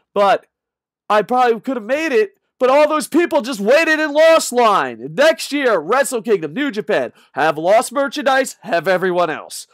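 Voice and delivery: loud, high, pleading demand; worked-up rant. Real, not acted. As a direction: demand it at full volume. Worked-up demand to New Japan.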